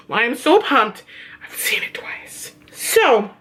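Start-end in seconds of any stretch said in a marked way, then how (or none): 1.4-2.5 whisper. Real, not acted. Confessing aside to viewers.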